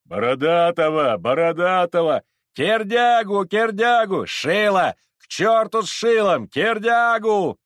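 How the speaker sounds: high, loud rival chants; crowd shouting names. Acted. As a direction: yell names like a rowdy mob. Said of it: "кричала толпа".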